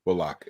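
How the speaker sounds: "Bullock" said BULL-lock; lock ending. Shown as a demonstration. Co-host testing the corrected name, "Bullock" as BULL-lock. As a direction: try out "Bullock" with a full "lock". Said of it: "Omar says it's Lock".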